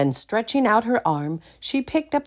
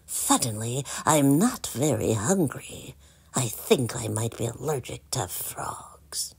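nervous whispered aside; 6 semitones lower, 6 dB quieter than usual. A narrator's reading. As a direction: voice drops lower and softer into a whisper. Prince shrinks from the frog.

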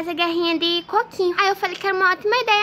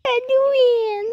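loud, drawn-out sob and wail; acted crying; 3 semitones higher, 3 dB louder, much slower than usual. Boy cries to mother over cut finger, wailing. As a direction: bawl that it hurts. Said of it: "filho, não chora".

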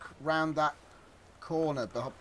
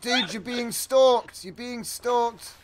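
dramatic warning; 6 semitones higher, 6 dB louder, slower than usual. Pretend.

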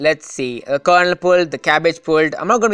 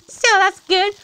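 squeaky voice; slower than usual. Acted.

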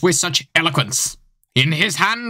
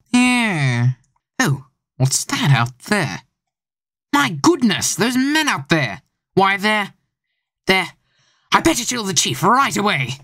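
a lightly voiced detective growing alarmed, rising higher, faster at the end; startled detective; 3 semitones higher than usual. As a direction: work yourself up, then rush to tell the chief. Smith spots men outside.

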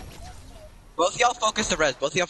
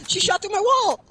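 high outraged gamer yell; raging gamer. Real, not acted. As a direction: explode at the unfair shot. Outraged at enemy shot.

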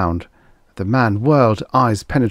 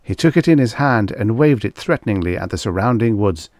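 plain reading voice

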